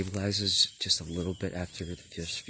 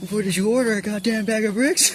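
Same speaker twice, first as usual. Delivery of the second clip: high, loud mocking yell; mock shouting; 13 semitones higher, 11 dB louder than usual. Mocking himself over his order, loud mock yell. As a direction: scold yourself in a shrill mock shout.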